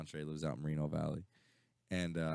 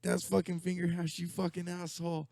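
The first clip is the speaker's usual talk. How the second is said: higher, louder mock outrage; mock angry friend; 13 semitones higher, 3 dB louder than usual. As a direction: call him an asshole half laughing. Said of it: "and he was like".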